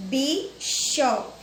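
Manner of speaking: "bicho" in two separate drawn-out beats, bi, cho; split into syllables. Shown as a demonstration. Teacher repeating a two-syllable example, split into syllables. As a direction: say "bicho" slowly broken, bi... cho. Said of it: "dois números de sílaba".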